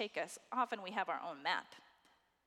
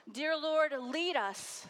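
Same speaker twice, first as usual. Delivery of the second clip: prayer; 4 semitones higher, 5 dB louder, slower than usual. Acted. Preacher breaks into prayer.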